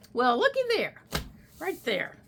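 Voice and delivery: delighted high voice. Real, not acted. Delighted at a found page.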